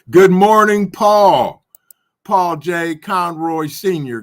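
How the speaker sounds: loud, high, cheerful hello; excited greeting. Real, not acted. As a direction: call out a big good morning to a friend. Excited greeting to chat viewer.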